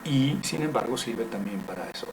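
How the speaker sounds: usual speaking voice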